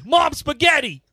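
[name] line. Shown as a demonstration. Friend retries the [name] lyric, shouting. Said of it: "That was good".

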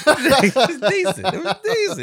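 words squeezed out high through laughter; helpless, high-pitched laughing speech; laughing hard. Real, not acted. Amused by co-host's acting, really laughing while talking.

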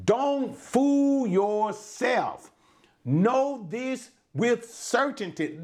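slow, high, each word weighted; emphatic. Shown as a demonstration. Preacher to congregation, quoting the verse emphatically. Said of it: "We translated it emphatically to say".

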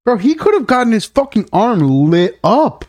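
aghast at the near miss. Real, not acted. Aghast at axe video.

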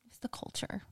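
whispered comeback. Real, not acted. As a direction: breathe it as a secret.

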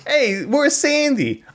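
high, slow greaser voice; Danny Zuko voice. Acted. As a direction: call out like a Fifties greaser. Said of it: "sounds like Travolta's character from Grease".

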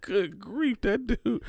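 high, mock-fed-up good grief; high fond teasing of the crying friend; amused exasperation. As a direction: tease him fondly.

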